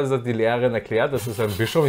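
normal talking voice

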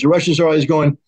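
ordinary talk

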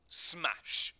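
loud, punchy smash; smash sound effect. A narrator's reading. Narrator voicing blackboard being smashed, loud smash. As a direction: hit it like a spade through a board.

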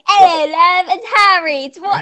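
put-on British accent. Friends act like they are British, hammed-up British accent. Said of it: "act like we have a British accent".

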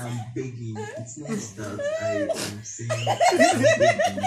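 squealing high through laughter; laughing plea. Real, not acted. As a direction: beg while cracking up.

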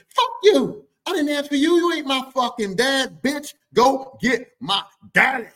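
high, loud furious telling off; sister cursing. Retold or imitated. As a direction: spit it at the uncle.

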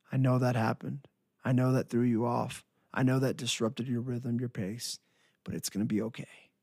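ordinary talk, nothing marked

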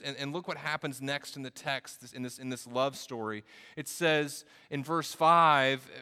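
impassioned preaching. Real, not acted. Preacher telling the church of God's grace, impassioned preaching. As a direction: preach it out with energy and emphasis.

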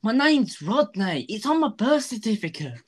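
high whiny Rodney voice; indignant put-upon young man; Rodney impression. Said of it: "the Rodney from Only Fools and Horses".

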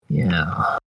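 low, drawn-out yeah; a hint of a mad scientist. Real, not acted. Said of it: "The way you said that, "yeah".

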